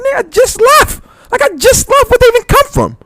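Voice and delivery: high-pitched freaked-out retelling; retold alarmed disbelief. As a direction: relive the panic of hearing he just got jumped. Retold panic after friend's fight.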